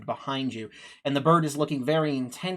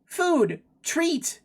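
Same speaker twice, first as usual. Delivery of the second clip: high, piping bird voice; tweeting bird; 12 semitones higher, much slower than usual. Acted. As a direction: tweet each word high, letting it fall. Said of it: "it tweets at you".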